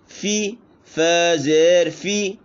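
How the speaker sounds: slow high फी, फा ज़ेर फी; drawn-out फी; spelling out. Shown as a demonstration. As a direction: teach फी clearly. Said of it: "ये इसकी पूरी अदायगी है".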